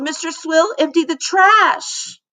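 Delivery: high, distressed voice; girl wailing the trash is gone. Acted. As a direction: keep wailing. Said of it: "she wailed".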